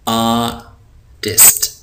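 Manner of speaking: आर्टिस्ट drawn out very slowly; slow model word. Shown as a demonstration. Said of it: "अगला लफ्ज़ है".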